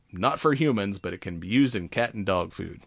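ordinary talk, nothing marked

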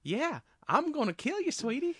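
high, peppy Southern sweetheart voice with a menacing threat; a sugary Southern accent, mocking a cartoon clock; sweet Southern accent. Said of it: "the peppy, like, sweet Southern accent".

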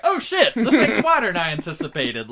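high yelp melting into a laugh; mock oops. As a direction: caught off guard, laughing it off. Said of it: "Will looks over like".

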